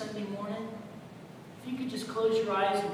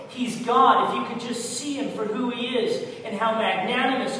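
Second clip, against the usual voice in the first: fervent sermon delivery, loud peaks; preaching; 4 dB louder than usual. Real, not acted. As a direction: preach it to the congregation. Preaching to the congregation.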